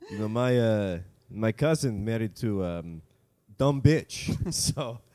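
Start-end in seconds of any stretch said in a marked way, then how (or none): none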